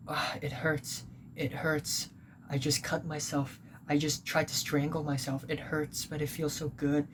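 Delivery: soft, pained mock moan; mock pained hush. Narrator mimics friend's dramatic texts, softly moaning. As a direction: hush it like a hurting drama queen. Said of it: "and then she's like".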